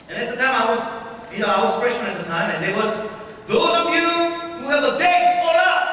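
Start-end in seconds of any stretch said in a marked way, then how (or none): 3.5-5.9 loud, high drill command to the freshmen; barked command. Retold or imitated. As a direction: call it out like an officer on parade. Officer orders freshmen at homecoming.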